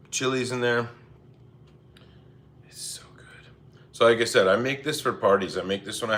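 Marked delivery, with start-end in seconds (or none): 2.7-3.5 breathy whispered praise of the food. Real, not acted. Streamer savouring cheese, whispering to viewers. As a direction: whisper it like a secret treat.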